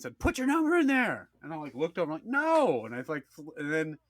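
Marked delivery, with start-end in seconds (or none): 0.2-1.3 high-pitched insistent order; acted angry demand. Retold girl demanding his number. 2.3-2.8 high emphatic no; retold indignant no; acted refusal. Retold flat refusal to her.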